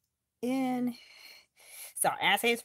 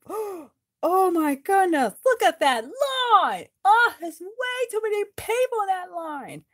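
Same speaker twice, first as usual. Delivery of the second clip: dismayed gasp; 8 semitones higher, 4 dB louder than usual. Acted. Dismayed at long line.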